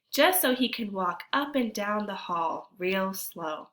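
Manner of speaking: normal talking voice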